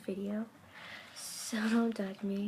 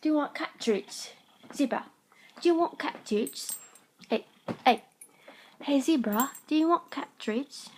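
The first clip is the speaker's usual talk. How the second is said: put-on loud, high-pitched British accent; 4 semitones higher, 8 dB louder than usual. Calling Zebra the cat for treats, British accent. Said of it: "British accents I do very well".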